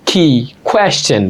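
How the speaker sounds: normal talking voice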